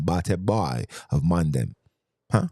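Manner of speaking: usual speaking voice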